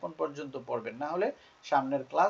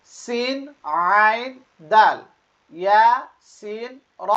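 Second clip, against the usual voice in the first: each letter name higher and drawn out; letter-by-letter recitation; 6 semitones higher, 9 dB louder, much slower than usual. Shown as a demonstration. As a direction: recite slowly for learners to repeat, pausing between letters. Leads learners reading along. (টেনে টেনে হরফ পড়া)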